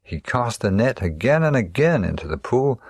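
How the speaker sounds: ordinary delivery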